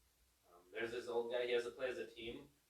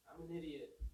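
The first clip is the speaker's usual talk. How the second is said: a lightly voiced Grinch, a bit softer, setting a trap; Grinch muttering; 5 semitones higher, 7 dB quieter than usual. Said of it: "And he's like".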